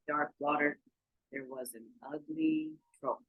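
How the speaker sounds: ordinary delivery, nothing marked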